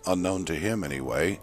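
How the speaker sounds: ordinary delivery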